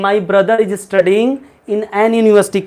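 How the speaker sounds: wrong example, slowly. Shown as a demonstration. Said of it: "कभी मत कहना".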